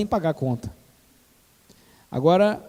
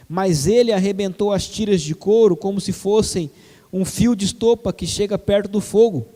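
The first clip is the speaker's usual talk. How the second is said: ordinary talk, nothing marked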